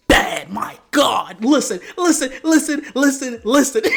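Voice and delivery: loud, worked-up swooning; hyped gushing. Real, not acted. Vlogger raving about Kirby's looks, loud excited gushing.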